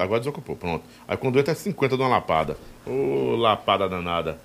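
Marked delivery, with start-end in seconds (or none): none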